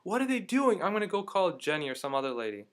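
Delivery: high, loud mock yell; screaming lady. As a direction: imitate an outraged onlooker calling out. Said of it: "this lady's screaming".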